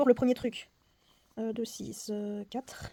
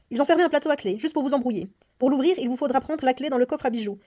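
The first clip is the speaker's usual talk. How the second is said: put-on louder, performed read of the old lady's warning; dramatic reading; 5 dB louder, faster than usual. Player reading the old lady's warning aloud, dramatic narration. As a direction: voice the clue like a tense story.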